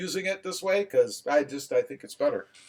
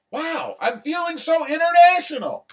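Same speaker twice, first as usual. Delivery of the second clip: excited; 9 semitones higher than usual. Real, not acted. Joking about Canadian viewers.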